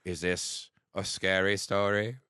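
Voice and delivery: slow, drawn-out mock Cockney delivery; Cockney ghost impression. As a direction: stretch it out like an old Cockney sailor telling a tale. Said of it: "talks like an old fucking Cockney sailor".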